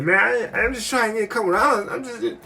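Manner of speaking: high, pleading excuse; mock self, high and defensive; whiny high voice. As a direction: squeak out a weak excuse.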